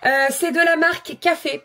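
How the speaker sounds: normal talking voice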